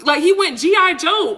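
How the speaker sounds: high-pitched exasperated rant; getting upset, with a little laugh; worked-up upset. Real, not acted. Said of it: "I'm getting upset all over again".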